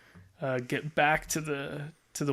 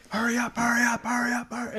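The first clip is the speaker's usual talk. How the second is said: high-pitched mock yelling; mock yelling boss; 9 semitones higher than usual. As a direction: imitate a boss frantically hurrying you along. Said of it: "he was yelling at you".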